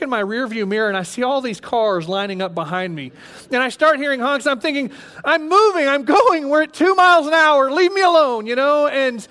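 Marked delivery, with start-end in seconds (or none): 5.2-8.4 raised, louder frantic pleading; panicked thoughts. Retold or imitated. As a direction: beg to be left alone in panic. Panicked inner thoughts on cliff.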